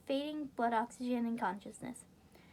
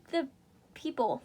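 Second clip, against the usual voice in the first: plain reading voice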